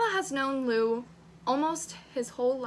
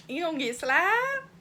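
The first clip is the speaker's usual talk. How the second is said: higher, sassy rising challenge; mock threat; 5 semitones higher than usual. Reader reacting to boy grabbing arm, mock threat. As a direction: playfully warn him with a rising pitch.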